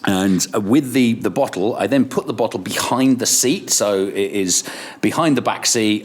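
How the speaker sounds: ordinary talk, nothing marked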